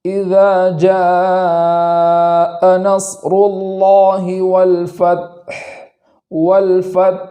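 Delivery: slow melodic tajweed chant; Quran recitation. Shown as a demonstration. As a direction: recite the whole verse slowly and tunefully. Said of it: "پوری آیت کو اس طریقے سے".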